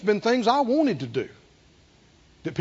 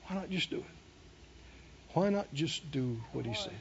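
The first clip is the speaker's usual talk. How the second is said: near-whispered plea, much softer; dropped hush; 7 semitones lower, 16 dB quieter than usual. Real, not acted. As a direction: pull them in with a hush. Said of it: "dropped to near silence".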